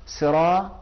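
ordinary delivery

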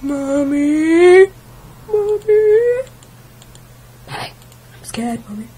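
put-on calling loud and high like a frightened kid; scared child. Imitating a six-year-old viewer calling mummy, scared. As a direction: cry for mummy like a small boy. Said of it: "a six-year-old at home".

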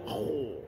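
kho with an overdone pout, drawn out; excessive pout. Shown as a demonstration. Teacher mocks the overdone lips, pouting kho. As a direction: push the lips out on a long kho. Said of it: "Berlebihan muncul".

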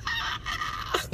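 very high mock shriek; quick child's squeal, retold; imitated baby squeal. Imitates grandbaby yelling at ceremony.

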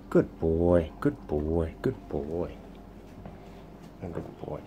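low, fading gentle praise; soft dog praise. Real, not acted. Praising Sonny after he comes, low and fading.

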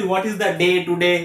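slow demonstration of Bengali-style English; English spoken with Bengali vowels; Bengali-accented English. Shown as a demonstration. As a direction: flatten day to "de" in a Bengali accent. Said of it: "এরকম করে তো বাংলা বলি".

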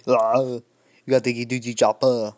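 loud Austrian-accented action hero growl; hammed-up Austrian accent impression; Arnold Terminator. Shown as a demonstration. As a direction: bark it in a thick Austrian accent. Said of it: "which is Arnold, A K A the Terminator".

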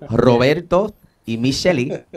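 names rolled out in a hammed-up Spanish accent. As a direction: say the couple's names the Spanish way, playfully. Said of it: "how to say it in a Spanish accent".

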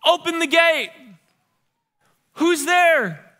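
proclaiming herald, calling to the gatekeepers. Retold or imitated. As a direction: announce it like a herald at the city gate. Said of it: "Did you all hear that?".

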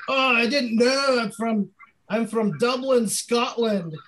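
a deliberately bad, high Scottish accent, slowed down; terrible fake Scottish accent. College guy at the frat door, bad fake Scottish accent. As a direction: fake a Scottish accent badly to get into the party. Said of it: "I did a terrible accent all night".

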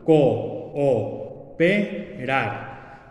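Co-o-pe-rar split into four slow syllables. Shown as a demonstration. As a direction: break Co-o-pe-rar apart, each syllable separate. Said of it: "separarlas en diferentes sílabas". (separando sílaba por sílaba)

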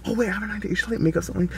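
ordinary talk

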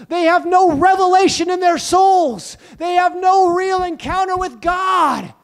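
full pulpit preaching. Acted. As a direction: preach it with rising force.

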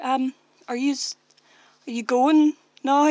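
slowly rephrasing for a confused stranger; hesitant slow retry. Retold or imitated. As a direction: spell it out slowly so he gets it. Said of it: "And I was like".

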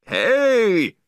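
high, loud outraged holler; angry shout. A narrator's reading. Beachgoer yelling at dog's owner, angry shout. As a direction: holler to get someone's attention, angrily. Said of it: "somebody shouted angrily".

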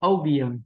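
"Opium." stress on the first syllable, falling; word demo. Shown as a demonstration. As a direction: model "Opium." clearly for students. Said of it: "Opium rất dễ rồi". (đọc mẫu "Opium." nhấn âm đầu)

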